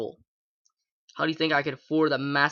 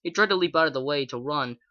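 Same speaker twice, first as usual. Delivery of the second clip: plain reading voice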